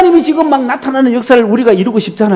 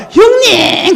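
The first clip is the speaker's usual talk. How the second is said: eager call to brother; 12 semitones higher, much slower than usual. Retold or imitated. Jacob greets brother Esau warmly.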